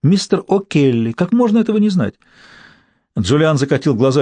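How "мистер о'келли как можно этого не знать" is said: lecturing scorn rising to high disbelief; disdainful. A narrator's reading. Mary Margaret lectures Julian on their president, disdainful. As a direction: start measured, climb to an incredulous high question. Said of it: "презрительно сказала".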